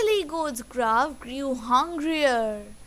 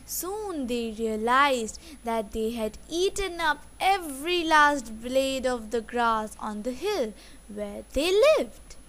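plain reading voice

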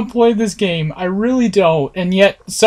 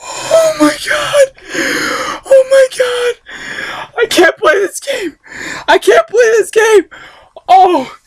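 much higher panicked cries; terrified; slower than usual. Real, not acted. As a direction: shriek it out in real fright. Panicked after jump scare.

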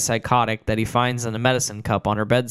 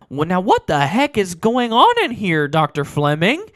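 Jerry's voice; 7 semitones higher, 4 dB louder than usual. Acted. Jerry's line, narrator voices him.

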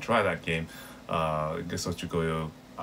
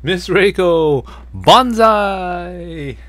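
high, loud, slow cheering call; high loud banzai cheer; 11 semitones higher, 11 dB louder, much slower than usual. Retold or imitated. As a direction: cheer her name triumphantly. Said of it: "the other guy's going".

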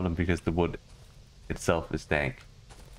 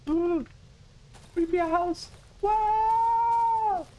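very high, long wavering ghost woo; spooky ghost voice; much slower than usual. Pretend. Gamer spooking at the creepy house in a ghost voice. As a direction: croon it like a cartoon ghost.

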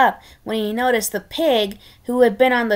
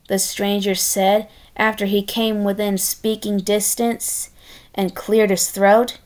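plain reading voice

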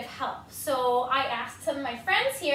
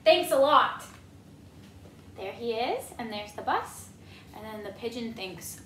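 usual speaking voice

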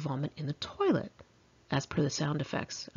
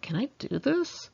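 curious inner thought; 8 semitones higher than usual. Retold or imitated. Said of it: "I thought".

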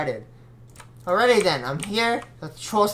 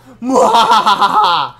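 high, loud villain cackle; evil laugh; 11 dB louder than usual. Acted. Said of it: "Let me do my evil laugh".

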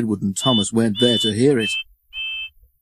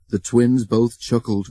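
plain reading voice

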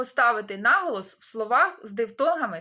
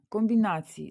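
normal talking voice

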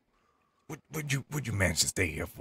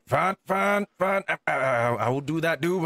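flustered; 9 semitones higher, 5 dB louder than usual. Real, not acted. Pushed into fetching friends.

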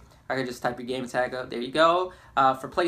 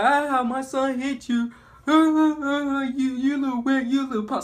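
higher, louder mock scolding; hammed-up accusing aunt; angry mother; 10 semitones higher, 5 dB louder than usual. Cousin's mother blaming the boy, mock angry scolding. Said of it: "she was like".

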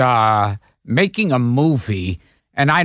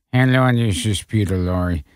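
terrible Peter Lorre; 7 semitones lower than usual. Retold or imitated. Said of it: "He did a terrible Peter Lorre imitation".

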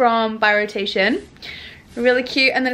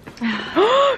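surprised gasp; 6 semitones higher than usual. Real, not acted. Sees friend bit cupcake.

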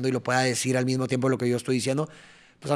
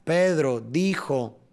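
"Pedro dijo" very slowly, stretched over the beats; slow, measured beats on "Pedro dijo"; rhythmic demo; much slower than usual. Shown as a demonstration. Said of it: "Entonces sería".

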